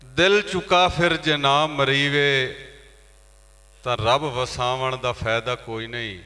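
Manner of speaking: declaimed verse with long drawn line endings; poetry recitation. Acted. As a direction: recite to a mushaira audience, each line falling away. (ਮੁਸ਼ਾਇਰੇ ਵਾਲੀ ਸ਼ਾਇਰੀ ਦੀ ਅਦਾਇਗੀ)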